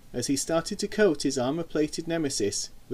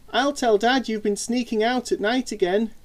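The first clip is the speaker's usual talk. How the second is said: ordinary delivery, nothing marked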